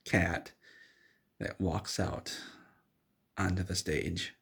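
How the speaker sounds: usual speaking voice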